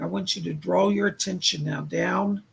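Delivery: usual speaking voice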